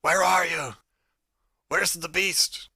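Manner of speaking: high, loud searching shout, last words slow. Acted. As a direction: call out loud into the fog. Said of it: "shouting in".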